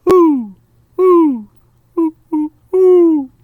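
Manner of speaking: high loud owl whoo; hooting owl call in the night. Acted. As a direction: call like an owl across the clearing. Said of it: "and then he called back".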